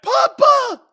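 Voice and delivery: very high, loud falsetto cry; mock Fredo wail. Mocking Fredo as the Don is shot, shrieking falsetto wail.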